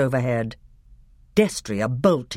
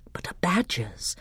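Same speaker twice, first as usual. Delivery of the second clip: awed whisper; 5 semitones higher, 5 dB quieter than usual. A narrator's reading. Shock of seeing the face.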